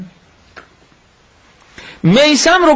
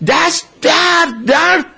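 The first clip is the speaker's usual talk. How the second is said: retold pain; 6 semitones higher, slower than usual. Retelling sudden agony in hand.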